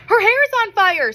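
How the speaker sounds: high loud panicked call; alarmed shout. A narrator's reading. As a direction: yell it across the room. Diner shouting in chaos.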